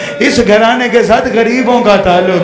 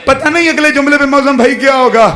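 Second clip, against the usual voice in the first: ordinary talk, nothing marked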